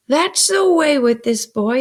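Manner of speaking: loud, high, slow complaint; exasperated exclamation. A narrator's reading. Sister exclaims over Pip's questions, exasperated. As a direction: complain emphatically about the child. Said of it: "exclaimed my sister".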